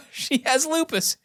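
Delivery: high, slow gushing echo; excited echo. Acted. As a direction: repeat it thrilled and amazed. Mother echoes excitedly.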